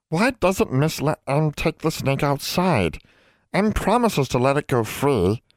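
high, slow elf voice; house elf. Acted. Elf asks young witch about freeing the snake, house elf voice.

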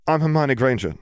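lightly voiced girl giving her name; girl introducing herself. A narrator's reading. Heroine introduces herself in dialogue.

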